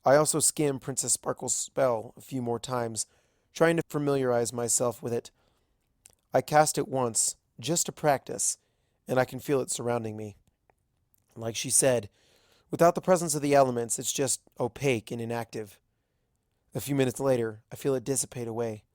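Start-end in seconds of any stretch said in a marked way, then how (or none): none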